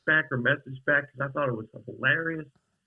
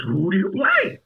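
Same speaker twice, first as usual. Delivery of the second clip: higher, louder eager question; eager fan; 7 semitones higher, 5 dB louder than usual. Retold or imitated. Old fan asking the actor about his role, high and eager. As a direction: imitate an excited fan pressing for the answer.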